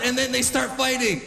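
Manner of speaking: ordinary talk, nothing marked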